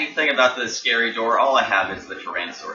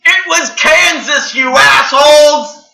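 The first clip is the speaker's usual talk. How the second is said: very high, loud, drawn-out mock outrage; listeners shouting correction; 11 dB louder, slower than usual. Podcaster as pissed-off listeners shouting the location, mock-furious scream. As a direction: scream the correction slowly, word by word. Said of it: "all your listeners shout the actual location".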